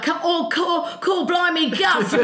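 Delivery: imitating a drunk American doing a high fake cockney; bad Englishman impression by a tipsy tourist; mock English accent. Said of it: "drunk Americans love to be like".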